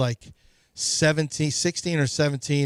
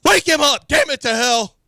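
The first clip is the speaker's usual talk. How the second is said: high, loud enraged yell; dad yelling; 11 semitones higher, 6 dB louder than usual. Retold or imitated. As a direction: scream it like a dad who's completely pissed off. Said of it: "my dad started yelling".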